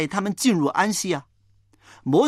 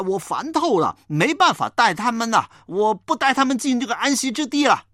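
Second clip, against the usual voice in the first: higher, exasperated outburst, fed up and done; exasperated Moses; 6 semitones higher than usual. Acted. Moses telling God he is fed up with the people, exasperated and higher. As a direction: pour out a weary fed-up complaint, rising higher. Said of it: "他真的是忍无可忍了".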